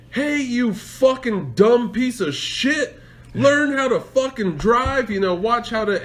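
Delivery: high-pitched retold road rage; angry outburst. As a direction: curse out the other driver, drawn out. Acts out own road rage outburst.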